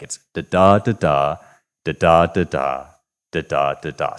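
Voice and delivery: da da da da chanted in steady iambic beats; scanning the meter. Shown as a demonstration. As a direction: tap out each foot with da da da da. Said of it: "he writes out what this line looks like".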